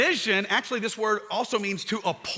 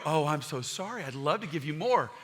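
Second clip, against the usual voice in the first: softer, sugary sympathy; mock soothing parent; 5 semitones lower, 5 dB quieter than usual. A parent gently soothing a whining kid, mock sweet. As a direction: coo like an overindulgent parent.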